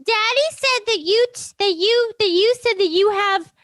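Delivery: high, mocking imitation of mom; mom's voice. Daughter mocking her mom on the phone, high whiny voice. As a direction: whine it like her nagging mother. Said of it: "She goes".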